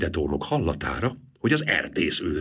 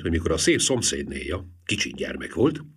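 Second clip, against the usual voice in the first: ordinary delivery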